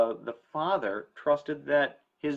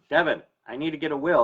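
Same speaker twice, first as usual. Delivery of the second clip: caller quote; 4 semitones higher, 9 dB louder, faster than usual. Retold or imitated. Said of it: "I get a call and I go".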